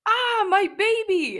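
high, drawn-out theatrical wail; hammed-up scream from the ground; loud, high, melodramatic cry of distress; mock scream. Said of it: "She was screaming".

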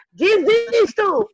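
a high, slow, loud theatrical cry of surrender; mock giving up. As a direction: throw up your hands, dragging it out. Mock despair over divorces.

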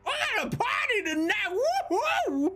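high-pitched, fast celebratory whoop; whooping party cry. Acted. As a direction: holler it like the party just started. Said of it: "he's like".

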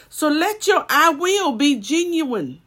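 stretched-out loud proclamation; preached climax. Acted. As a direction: proclaim it as the sermon's point. Closing point, preached loud.

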